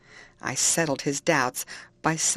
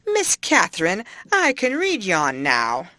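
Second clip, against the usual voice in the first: clumsy, proud country lad, louder and slow; slow, loud, awkward yokel pride; awkward elation; 3 semitones higher, 5 dB louder than usual. A narrator's reading. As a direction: blurt it out, awkward and pleased with himself. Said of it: "with a stupid mixture of awkwardness and elation".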